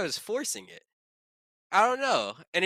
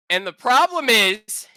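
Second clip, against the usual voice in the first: emphatic rant; 7 semitones higher, 9 dB louder than usual. Real, not acted. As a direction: raise voice and pitch, pausing between phrases.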